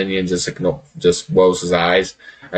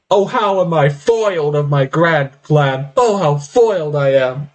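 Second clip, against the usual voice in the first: hammed-up foiled villain, higher; mock villain lament; 6 semitones higher than usual. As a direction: sigh it out like a cartoon villain. GM voicing gloating villain lament.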